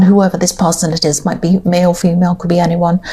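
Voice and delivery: talking through hiccups; hiccuping between words. Real, not acted. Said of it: "excuse me, I've got the hiccups".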